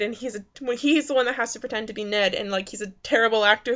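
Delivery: normal talking voice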